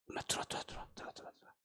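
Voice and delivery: urgent hushed whisper, no voice at all; sneaking friends in past the guard, hissing come in. Retold or imitated. Young man at the dorm gate whispering to friends after curfew.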